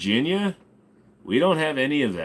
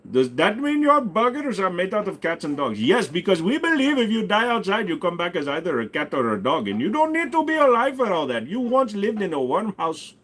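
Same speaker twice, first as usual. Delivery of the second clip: higher put-on Indian accent throughout; 8 semitones higher than usual. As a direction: answer in a hammed-up Indian persona. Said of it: "This accent is not doing too good".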